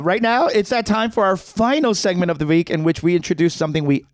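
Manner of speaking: high, hyped announcer read; show host intro. Acted. Host introducing the weekly segment, high announcer. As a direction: announce the next segment with flair.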